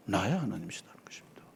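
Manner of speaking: hushed, fast and breathy; 나의 하나님이시다 whispered low; quick whisper. Real, not acted. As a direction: let it fall to a whisper. Preacher drops to whisper.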